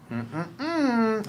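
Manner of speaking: disapproving hum. Acted.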